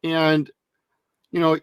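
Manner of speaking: ordinary talk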